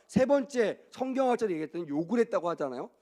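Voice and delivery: ordinary delivery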